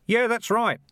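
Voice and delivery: high-pitched call from the crowd; mild shout of support; shouted agreement. A narrator's reading. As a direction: chime in from the back, high. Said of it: "someone else shouted".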